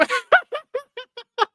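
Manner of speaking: high-pitched giggling laugh. Real, not acted. Streamer reading Bob's comment to Michael, high laughter.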